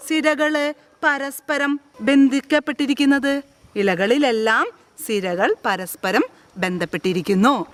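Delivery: ordinary talk, nothing marked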